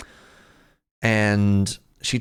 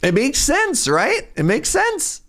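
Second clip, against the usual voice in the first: squeaky, far higher protest; high-pitched insistence. Acted. Defending the student's cold cat logic, high-pitched insistence. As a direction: insist on it way up at the top of your voice.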